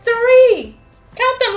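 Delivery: usual speaking voice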